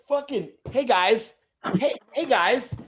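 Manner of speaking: high squeaky elf calling out. Pretend. Elf on the shelf calls to the boys, high and squeaky. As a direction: call out like a cheeky little elf.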